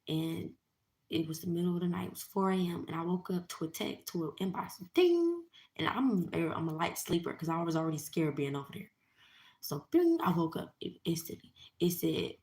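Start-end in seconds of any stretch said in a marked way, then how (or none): 4.9-5.4 high, loud, drawn-out ding; imitated phone ding. Imitating the inbox alert that woke her, high ding. 9.9-10.2 high, loud bing; imitated phone bing. Imitating the alert that woke her, high bing.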